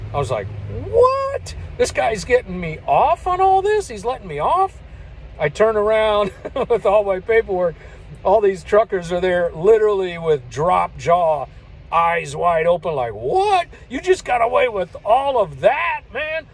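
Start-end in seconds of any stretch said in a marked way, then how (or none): 0.9-4.8 high startled what, then amazed; can't believe your luck; stunned disbelief. Retold or imitated. Trucker amazed the trooper let him off, high disbelief. 13.1-16.5 amazed disbelief. Retold or imitated. Truckers amazed at the escape.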